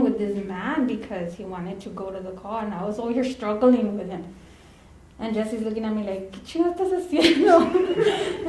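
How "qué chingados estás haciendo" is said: high, loud rebuke through laughter; mock exasperated scolding. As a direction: imitate a partner telling you off. Partner scolds teller, retold laughing.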